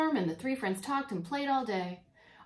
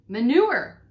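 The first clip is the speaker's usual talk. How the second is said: much louder proud announcement; proud girl revealing her gift; triumphant; 3 semitones higher, 14 dB louder than usual. A narrator's reading. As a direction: present it like a prize. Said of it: "said Winnie triumphantly".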